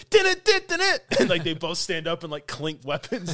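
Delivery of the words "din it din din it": loud, high-pitched musical sting; imitated soundtrack. Mimicking the film's music cue, punchy musical sting. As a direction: belt out a dramatic film cue. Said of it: "the music goes like".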